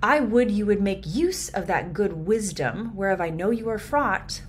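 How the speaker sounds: measured verse reading; Shakespeare reading. Shown as a demonstration. Reads the verse.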